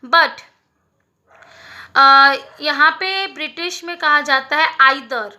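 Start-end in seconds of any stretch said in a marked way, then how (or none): none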